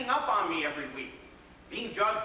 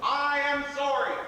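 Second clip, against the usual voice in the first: higher, louder, slow holler from the roof; hollered apology; 7 semitones higher, 5 dB louder, slower than usual. Acted. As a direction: shout it over the city.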